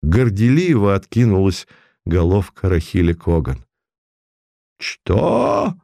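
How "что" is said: very high squeal of outrage; shriek. A narrator's reading. The tribunal shrieks at her confession, very high. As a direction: shriek in disbelief. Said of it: "взвизгнул кагальный трибунал".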